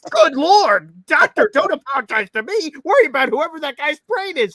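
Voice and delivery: high, loud mock outburst; shocked exclamation. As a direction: exclaim it in exaggerated disbelief. Acts out his shocked reply to doctor.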